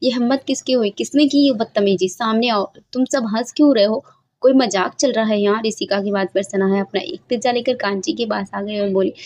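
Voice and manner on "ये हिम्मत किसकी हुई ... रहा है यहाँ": ordinary delivery, nothing marked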